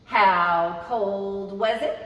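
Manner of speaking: drawn-out question for little listeners; slow storybook refrain. Acted. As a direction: ask it slowly like a refrain.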